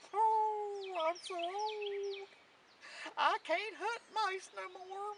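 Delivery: high, slow, yowling cat voice; old tomcat. A narrator's reading. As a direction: draw the words out like a meowing old cat. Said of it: "It was an old tomcat".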